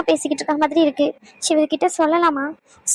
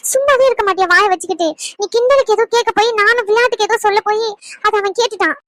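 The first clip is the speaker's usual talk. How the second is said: higher-pitched, exasperated scolding; agitated blaming; 5 semitones higher than usual. Acted. Woman scolds friend whose teasing got overheard, agitated blaming. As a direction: blame the friend in a raised, flustered pitch.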